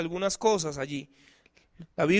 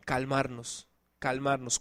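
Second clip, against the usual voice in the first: soft repeated calmarnos; 8 semitones lower, 9 dB quieter than usual. Shown as a demonstration. Preacher repeats key word softly.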